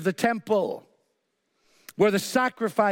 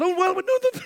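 high-pitched mock fluster; imitating an embarrassed husband, pitched way up; flustered backtracking; 12 semitones higher than usual. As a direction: hurriedly backpedal in a squeaky high voice. Husband backtracks to offended artist.

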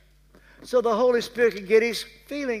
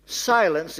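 ordinary talk

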